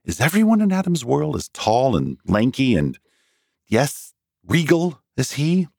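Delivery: a touch of mocking awe; mock regal. A narrator's reading. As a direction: sneer at their grandeur. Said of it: "he wondered crossly".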